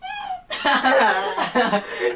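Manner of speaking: helpless ticklish giggling; laughing while tickled; being tickled. Real, not acted. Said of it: "You're tickling me at the same time".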